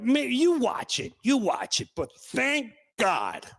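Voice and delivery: usual speaking voice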